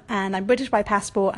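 ordinary delivery

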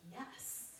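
breathy, hushed yes; a private yes to the altar call; whispered yes. Retold or imitated. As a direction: whisper it to yourself. Silent inner answer.

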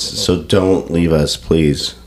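ordinary talk, nothing marked

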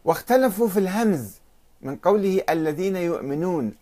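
ordinary delivery

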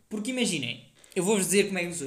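normal talking voice

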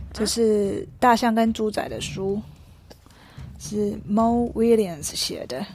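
usual speaking voice

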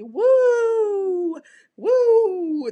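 loud mocking woo. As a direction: cheer it like a hype guy. Mock cheer in character.

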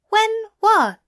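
insistent repeated question, pitched high; Aziraphale asking again. A narrator's reading. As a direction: lean in and press harder.

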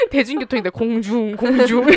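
giggling at own mistake; laughing. Real, not acted. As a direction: laugh while repeating the word. Laughs at own word slip. (웃음 섞인 말투)

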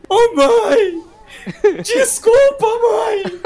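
high, loud, tearful pleading; crying desperately. Retold or imitated. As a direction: wail to mom like a drunk kid in tears. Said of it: "chorando, desesperado já".

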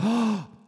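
loud, high mock-scandalized gasp; sharp theatrical intake of breath; shocked gasp. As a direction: gasp at your own dirty joke. Shocked at own crude joke.

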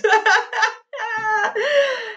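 high, drawn-out cackling laugh; acted laugh. A narrator's reading. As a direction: laugh high and long at your own remark. Character laughs after money joke. (hoog, langgerekt gelach)

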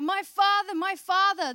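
loud anguished cry of my father; a son crying out to his departing master. Acted. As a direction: call out after him, loud and high. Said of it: "Elisha saw this and cried out".

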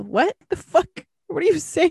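high, incredulous retort breaking into laughter; baffled disbelief. Retold or imitated. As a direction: blurt it out, baffled and amused. Baffled reply to scammer retold.

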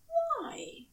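very high, soft angel voice; a hesitant little angel question; high, quiet, uncertain angel; wary angel. A narrator's reading. Aziraphale voiced in story.

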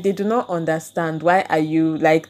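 normal talking voice

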